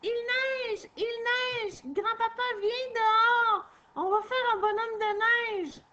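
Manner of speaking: put-on high, loud little girl's call; a little girl shouting to grandpa to come out; excited child calling. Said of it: "crie Eugénie".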